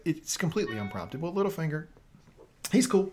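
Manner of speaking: usual speaking voice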